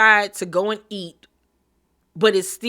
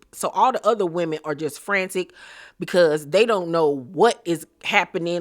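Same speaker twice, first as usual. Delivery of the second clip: ordinary talk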